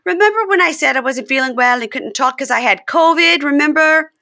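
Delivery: high, loud sing-song coaxing; hammed-up wheedling confession; mock Jasmine coaxing. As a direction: sweet-talk him into it. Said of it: "And she goes".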